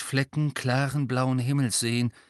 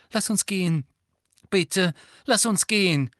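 higher, anxious pleading; high, frightened voice; 8 semitones higher, 4 dB louder than usual. A narrator's reading. Hermine anxiously urging Hagrid away, higher pitched. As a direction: beg him to leave, scared. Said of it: "mit hoher und angsterfüllter Stimme".

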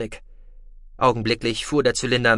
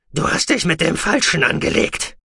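loud, raised-pitch angry outburst; furious threat; 4 semitones higher, 4 dB louder than usual. A narrator's reading. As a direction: warn him off in a rage. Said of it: "entgegnete Goloschier wütend".

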